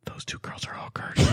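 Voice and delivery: hushed unvoiced whisper to a friend; whispered aside. Whispering to his friend Jenny at breakfast. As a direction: lean in and whisper it secretly.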